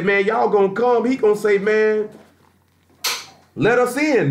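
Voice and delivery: pleading Esau. Acted. Esau begging at shut door.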